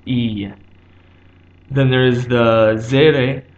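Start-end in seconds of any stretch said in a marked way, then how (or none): 0.0-0.5 "E" as a pure long ee; Hebrew E sound. Shown as a demonstration. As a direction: hold "E" as a clean ee vowel. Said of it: "complete the E sound".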